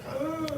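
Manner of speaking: high, guttural vampire grunt; a campy monster groan; mock vampire grunt. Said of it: "we talk like".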